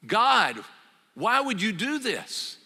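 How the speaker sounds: angry Jonah. Acted. Jonah complaining to God after Nineveh repents, mildly angry. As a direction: demand an answer from God, hurt. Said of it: "No, Jonah is angry".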